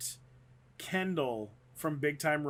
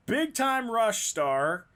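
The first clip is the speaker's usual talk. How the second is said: headline read; 4 semitones higher, 4 dB louder, slower than usual. Retold or imitated. Reading out the tabloid headline.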